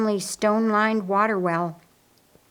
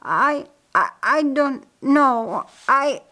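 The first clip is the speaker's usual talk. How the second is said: slow, higher, stammered slur; slurring; 6 semitones higher, 4 dB louder, slower than usual. A narrator's reading. Laz answers his sister while collapsing, slurring. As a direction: drag the words out as if stricken. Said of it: "slurring his words".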